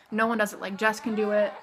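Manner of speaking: ordinary delivery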